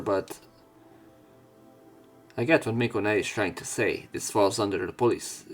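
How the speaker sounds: ordinary talk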